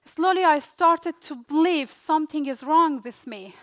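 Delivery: normal talking voice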